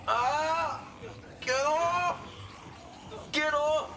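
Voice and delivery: high, urgent cries; alarmed yelp. Real, not acted. Player grabbed in a game, high-pitched alarm. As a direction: yelp it high, shaking off attackers.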